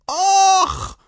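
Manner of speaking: very high, loud exclamation; satisfied shudder. A narrator's reading. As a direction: shake off the burn of schnapps. Rider shudders at the schnapps. (lautes, hohes Schütteln nach dem Schnaps)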